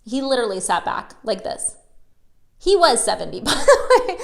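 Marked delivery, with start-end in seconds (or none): 2.6-4.2 laughing through the words, louder; amused disbelief, laughing. Real, not acted.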